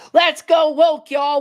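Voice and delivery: high, loud mocking cheer; mock pep-rally shout of the company; sarcastic mock slogan. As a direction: sneer it like a fake rally cry. Mocking the Harley bosses.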